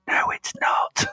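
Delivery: hushed whispered aside. Pretend. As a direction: breathe it like a secret. Mock dramatic whispered denial.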